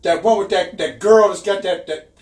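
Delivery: mock outraged friend, laughing; imitating Frank. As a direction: stammer it out offended. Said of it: "And he goes".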